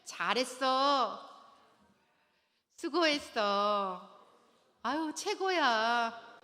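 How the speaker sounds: loud, drawn-out model praise; demonstrated praise. Shown as a demonstration. Coach modelling a wife's praise of her husband, drawn-out encouragement. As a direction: stretch each compliment out for the class to repeat. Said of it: "우리 여자들 따라해볼까요".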